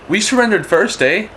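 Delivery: high-pitched Canadian claim ending on "eh"; improvised Canadian. Acted. Canadians racing the French to surrender, improvised Canadian voice. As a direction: pipe up as a mock Canadian. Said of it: "Canadians, they're gonna go".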